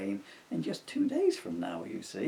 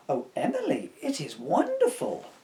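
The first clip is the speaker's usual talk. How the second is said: high, laughing delight; delighted exclaim; 12 semitones higher, 6 dB louder than usual. Acted. Mum and dad gush over the doll's house, high and delighted. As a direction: gush with joy at the gift.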